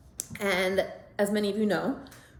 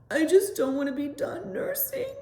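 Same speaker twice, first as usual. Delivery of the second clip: put-on high, wailing child's voice; mother voicing her son bawling about weaning; crying little boy; 9 semitones higher than usual. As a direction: imitate the boy sobbing out his wish.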